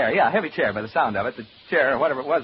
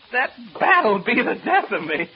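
high, loud, exasperated cry; alarmed exclamation; 7 semitones higher, 5 dB louder than usual. Acted. Man just attacked by a bat, alarmed exclamation. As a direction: cry it out, rattled by the scare.

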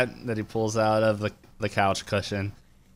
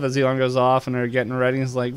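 usual speaking voice

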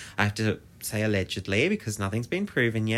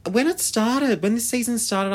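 usual speaking voice

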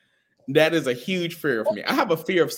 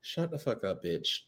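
soft, low disbelief; quiet mock scold; hushed disbelief; 5 semitones lower, 10 dB quieter than usual. Real, not acted. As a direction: murmur in shocked recognition. Hushed recognition.

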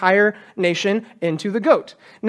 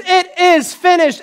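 "finished" cried out high and slow; a high, slow triumphant cry; loud cry; 8 semitones higher, 4 dB louder, slower than usual. Retold or imitated. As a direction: proclaim it with a loud voice. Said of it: "Jesus cried out with a loud voice".

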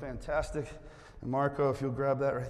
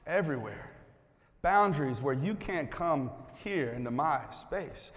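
ordinary talk

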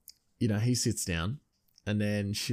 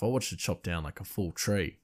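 ordinary delivery

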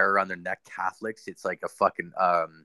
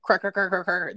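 rapid high-pitched creak noises; imitated creaking; 11 semitones higher than usual. Showing a friend what floor creaks don't sound like, imitated creaking. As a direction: mimic a repetitive floor creak, voice jumping way up. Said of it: "creaks in the fucking floor don't make repetitive".

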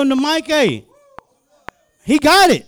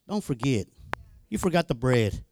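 low, soft retold reminder; quiet chiding; 11 semitones lower, 9 dB quieter than usual. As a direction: drop deep and hushed to reprove. Voicing Jesus chiding the disciples.

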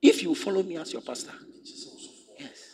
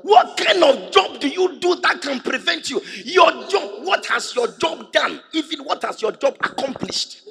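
shouted, sing-song preacher challenge; preaching at full force; 8 semitones higher, 11 dB louder than usual. Real, not acted. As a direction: hammer the question home, chanting.

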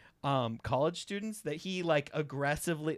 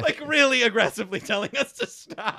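loud, high, through laughter; laughing emphasis; 10 semitones higher, 11 dB louder than usual. Real, not acted. Comic recalling train riders' reaction, laughing loudly. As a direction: blurt it out louder, cracking up.

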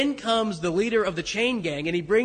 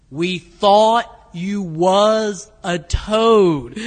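very slow and much louder, every word spelled out; much slower; 11 dB louder, much slower than usual. Retold or imitated. Delbert repeating himself to a baffled Pete, very slow and much louder. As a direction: drag each word out for a friend who isn't getting it. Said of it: "this time slower".

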